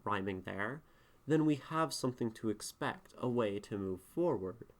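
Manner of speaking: normal talking voice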